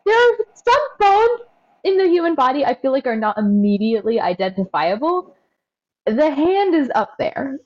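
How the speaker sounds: normal talking voice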